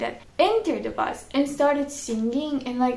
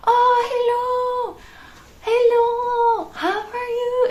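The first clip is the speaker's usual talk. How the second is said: high, slow, bright mock greeting; excited stranger; 11 semitones higher, 5 dB louder, much slower than usual. Stranger on a tricycle greets the vlogger, high mock excitement. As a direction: play an overeager stranger calling hello.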